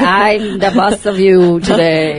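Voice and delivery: slow, loud declaration of dominance; imitating bossy boss. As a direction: lay it down slowly and loudly as the boss. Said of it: "she was like".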